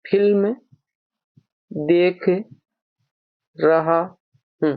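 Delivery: each word separated by long pauses. Shown as a demonstration. Teacher showing students the sentence word by word, slowly. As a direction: lay the sentence out one word at a time. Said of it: "अब देखिए, देखना".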